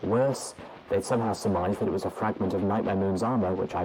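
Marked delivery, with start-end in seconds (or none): none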